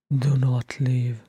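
slow, subdued, voiced plea; pleading. A narrator's reading. Thorin asking Bilbo to stay, subdued plea. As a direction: ask it with restrained longing.

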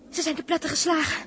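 normal talking voice

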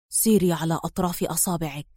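low, hushed urgent order; whispering. A narrator's reading. Man pushing her away from the door, whispering. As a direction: drop right down, soft and secretive. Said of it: "وهو يدفعها أمامه هامسا".

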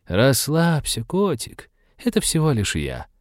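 a hint of a purr, coaxing; purring woman voice. A narrator's reading. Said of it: "Очень уж знакомо промурлыкала воровка".

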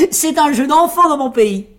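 high, loud boast; tailleur vantard. A narrator's reading. Tailleur se vante. (vantardise d'une voix perchée)

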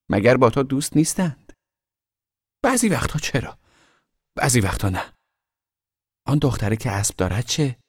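put-on two boys chatting in a treehouse. Two brothers talking in the treehouse.

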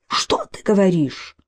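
stunned whisper; shocked whisper. Acted. Гарри just heard his wife is dead, whispering in shock. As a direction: barely get the words out, stunned.

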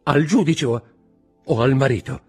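high, loud, faltering question; stammering. A narrator's reading. Wife asks whom she must answer, stammering. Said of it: "balbettò la signora di Villefort".

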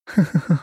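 a lady's teasing laugh; flirty high laugh. Acted. Khrushcheva laughs into phone.

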